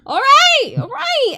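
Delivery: high, loud answering call; calling back. Acted. Answering the shout to look for Carla, calling back.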